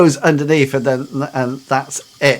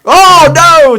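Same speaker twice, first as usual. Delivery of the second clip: high, loud cry of mock dismay; alarmed outcry; 13 semitones higher, 10 dB louder than usual. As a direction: cry out as if disaster looms. Game master's mock alarm at cleric fighting.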